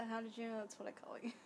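fast hushed whisper. Pretend. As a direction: whisper it as a private joke. Joking reply, whispered.

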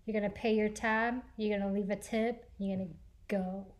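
low, quiet, menacing orders; lightly voiced stern bouncer; deep firm voice. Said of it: "inner Liam Neeson".

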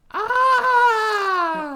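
long, high-pitched loud scream; impression of screaming. Friend showing someone screaming at the monster, high mock scream. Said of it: "my impression of someone screaming".

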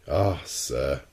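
low, drawn irony; a touch of dry irony; ironical. A narrator's reading. Captain answers the doubting professor, ironical. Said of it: "in an ironical tone".

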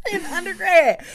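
said through laughter, pitch jumping high; amused. Real, not acted. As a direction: giggle the words out. Laughing over her own joke.

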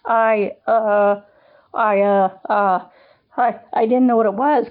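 nervous stammer, repeating I uh; stuttering. Acted. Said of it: "Kevin stuttered out".

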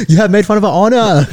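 high caricature of an offended Chinese gangster; mock Chinese accent. Host as triads avenging honor, hammy Chinese. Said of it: "What if the triads come after us?".

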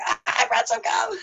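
high breathy friend voice; friend's voice. Retold or imitated. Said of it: "Ever's like".

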